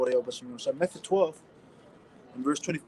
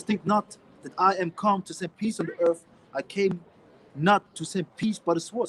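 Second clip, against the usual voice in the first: louder, slow King James reading; scripture reading; 4 dB louder than usual. Acted. Preacher reading Matthew aloud to his listeners, slow and emphatic. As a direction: read the verse out like from the pulpit.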